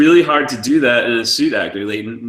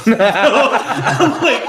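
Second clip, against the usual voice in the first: laughing; 12 semitones higher, 5 dB louder than usual. Real, not acted. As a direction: burst of real laughter. Laughing at the offer.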